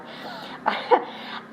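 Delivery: soft breathy laugh; a little self-conscious chuckle; light laugh. Real, not acted. Laughing at herself after asking viewers what they say. Laughs at her own question.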